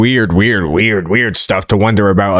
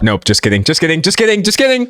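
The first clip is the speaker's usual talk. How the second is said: rapid-fire repeats climbing higher and higher; joking retraction; 6 semitones higher, faster than usual. Pretend. Host retracting a fake sponsor plug, pitch climbing. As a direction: start normal, end in a high squeak.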